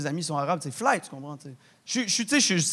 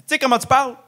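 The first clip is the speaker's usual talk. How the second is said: higher, louder mock scold; mocking quote; 6 semitones higher, 5 dB louder than usual. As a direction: sneer it like a judgy guy. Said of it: "Il dit". (ton moqueur, plus aigu et plus fort)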